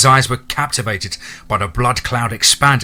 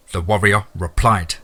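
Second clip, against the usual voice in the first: usual speaking voice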